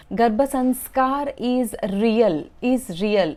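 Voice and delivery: "Garbha sanskar is real" drawn out slowly, the repeated "is real" punched; emphatic insistence. Real, not acted. Mother telling viewers the practice works, slow and emphatic. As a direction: lean on every word, slowly, then hammer "is real".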